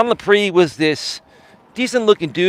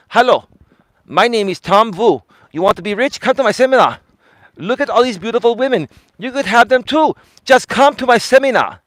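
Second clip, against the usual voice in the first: Tom Vu; 8 semitones higher than usual. Retold or imitated.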